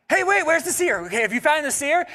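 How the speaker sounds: eager mock Saul. Saul voiced in retold scene.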